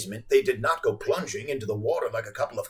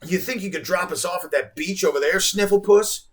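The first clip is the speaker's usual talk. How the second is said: impolite request; 6 semitones higher, 4 dB louder than usual. A narrator's reading. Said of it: "she very impolitely requested".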